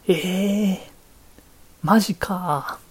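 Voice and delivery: hammed-up "no way" reaction; imitating amazed TV panelists. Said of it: "番組の出演、出演者".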